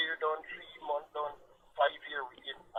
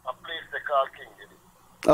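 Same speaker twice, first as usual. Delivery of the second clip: louder and slower for clarity; speaking up; 8 dB louder, slower than usual. Real, not acted. Caller asked by host to speak up, speaking up slowly. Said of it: "You have to speak up a little bit".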